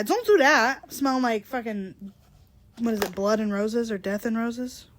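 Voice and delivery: usual speaking voice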